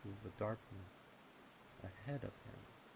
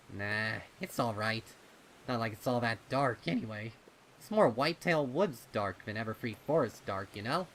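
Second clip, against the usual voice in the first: Snips, reassuring; 3 semitones higher, 9 dB louder than usual. Acted. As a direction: shrug it off for your friend, casually. Snips voiced by narrator.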